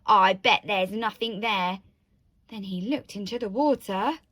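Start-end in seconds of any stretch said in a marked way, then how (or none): 0.1-1.8 loud, dismissive dad voice; storybook bluster; scoffing. A narrator's reading. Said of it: "strode onto the bridge and scoffed".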